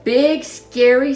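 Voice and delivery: ordinary delivery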